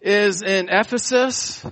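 rising, building preacher cadence; preaching. Acted. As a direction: build the list upward. Preacher listing cities, rising.

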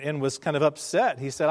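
usual speaking voice

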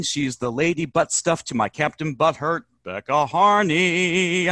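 usual speaking voice

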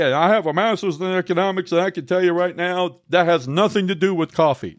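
lightly voiced condescending academic; mock know-it-all. A college-educated emailer lecturing the host, in a mildly mocking voice. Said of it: "Or somebody".

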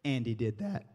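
put-on breathy scoff then low, soft toddler voice; a little boy shrugging off blame; toddler brushing it off. Toddler denying the mess, soft dismissive scoff. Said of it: "And he goes".

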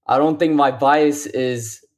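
usual speaking voice

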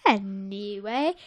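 ordinary talk, nothing marked

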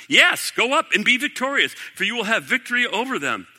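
high, mock-enthusiastic cheer; sarcastic. A narrator's reading. Micaiah answers King Ahab about going to war, sarcastically.

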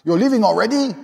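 high put-on old guy's voice; old party guest. Old guy questioning a guest's early exit, high put-on voice. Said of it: "some old guy comes up".

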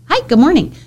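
high, bright cheerful hello; cheerful greeting. Shown as a demonstration. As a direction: greet them brightly and warmly. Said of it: "If you say good morning".

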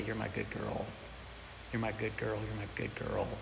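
very soft, hushed comfort; soothing whisper to child. Retold or imitated. Father soothing his scared daughter back to sleep, hushed. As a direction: murmur it gently to calm a frightened child.

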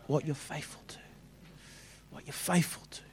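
breathy whispered repeat; whispering. Shown as a demonstration. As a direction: whisper it for weight. Hushed repeat for emphasis.

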